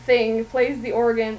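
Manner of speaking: ordinary talk, nothing marked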